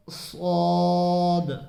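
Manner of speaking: सौद drawn out very slowly, heavy and rounded; full-mouthed saad. Shown as a demonstration. Teacher to viewers, a long full-mouthed सौद. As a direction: fill the mouth and stretch सौद. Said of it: "पुर करके पढ़ेंगे".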